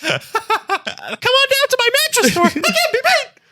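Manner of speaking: squeaky salesman giggling through; high-pitched ad voice. Retold or imitated. Mocking mattress commercial.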